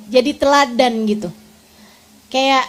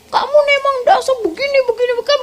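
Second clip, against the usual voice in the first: angry husband; 10 semitones higher, much faster than usual. Retold or imitated. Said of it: "begitu tuh".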